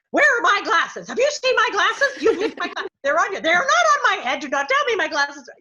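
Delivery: loud, high mock-indignant rant; hammed-up demanding older colleague in a flap; opinionated fussing. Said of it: "She was the kind of person".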